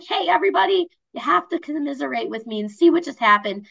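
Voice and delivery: high, lively call to an audience; rally everyone to commiserate, pitch jumping up; excited announcement. Acted. Social person posting about a broken-down car, excited announcement. Acting a social media post.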